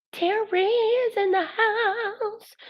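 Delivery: high, slow sing-song call-out; drawn-out high welcome call; hype announcer. Acted. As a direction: announce Terry like a host calling a guest in. Greeting a viewer who joined the stream.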